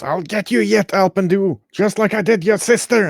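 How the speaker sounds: high, taunting witch voice; hag voice. Acted. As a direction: sneer it out like a spiteful old hag. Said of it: "the hag goes".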